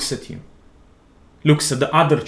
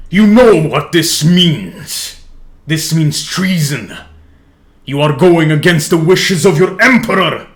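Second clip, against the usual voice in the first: emperor yells; 3 semitones higher, 6 dB louder than usual. Acted. The emperor yells at Grey for disobeying, loud and furious. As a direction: hammer treason at him like a sentence. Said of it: "His emperor yells at him".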